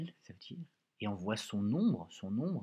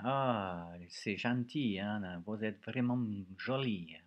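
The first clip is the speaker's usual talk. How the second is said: French in a sing-song Italian accent; demonstrating an Italian accent in French; slower than usual. Shown as a demonstration. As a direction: put on a drawn-out Italian accent, slowly. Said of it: "je fais l'accent, euh, l'accent italien".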